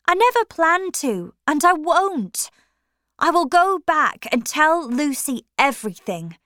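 put-on resolute young woman. A narrator's reading. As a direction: end it once and for all. Maggie firmly refuses Stephen.

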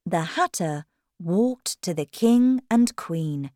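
ordinary delivery, nothing marked

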